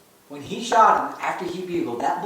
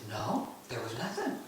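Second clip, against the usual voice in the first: low, very soft reply; very calm; 6 semitones lower, 16 dB quieter than usual. Retold or imitated. Son answering dad about the delayed shot, very calm. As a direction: answer calmly, barely above a murmur. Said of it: "and very calmly".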